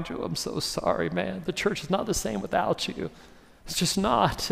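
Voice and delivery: a friend weeping quietly beside a hospital bed; tearful whisper. Acted. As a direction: whisper it through tears at his bedside. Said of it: "and they're crying".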